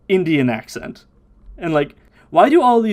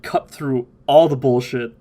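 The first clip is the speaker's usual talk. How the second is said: normal talking voice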